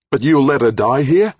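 high, loud accusing cry; desperate outcry. A narrator's reading. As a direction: throw the accusation at him. Desperate woman accusing official.

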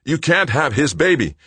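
loud, high, agitated objection; desperate protest. A narrator's reading. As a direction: forbid it in rising panic. Son frantic over mother's pregnancy.

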